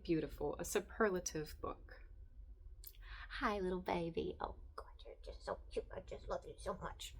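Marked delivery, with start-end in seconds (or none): 3.4-7.1 softer, hushed cooing at a pet; soft, fast, breathy pet talk. Real, not acted. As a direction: murmur fondly to the kitten. Cooing at her kitten.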